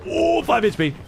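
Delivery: sudden high-pitched gasp of excitement; excited. Real, not acted. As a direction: shoot up high in disbelief. Watching a close tournament fight.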